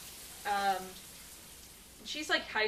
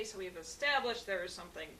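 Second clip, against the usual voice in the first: ordinary talk, nothing marked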